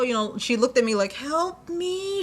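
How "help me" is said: a high, small mock plea, like a deer in headlights; silent plea for help. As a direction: voice the panicked coworker begging to be rescued. Coworker's panicked look voiced.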